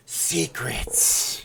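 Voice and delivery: hushed, drawn-out whisper; secretive stage whisper. Shown as a demonstration. Streamer teasing viewers with a YouTube hack, secretive whisper. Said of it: "You gotta say it like that".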